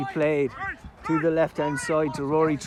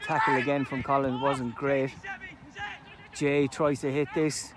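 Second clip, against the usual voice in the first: normal talking voice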